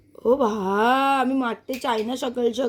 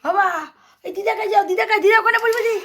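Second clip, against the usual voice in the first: calling out; 8 semitones higher, 3 dB louder than usual. Real, not acted. Calling child toward grandmother.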